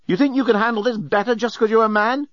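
high-pitched, affronted woman's retort; indignant challenge. A narrator's reading. Nettie challenges the journalist for being a man, indignant. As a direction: push back with rising indignation.

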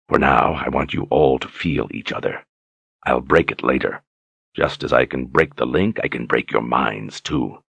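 low, smug, threatening villain; menacing warlord. A narrator's reading. Voices the villain Jagang.

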